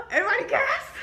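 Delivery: a high, hammed-up gasp of shock; mock gasp. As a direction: imitate the whole room gasping. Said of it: "everybody was like".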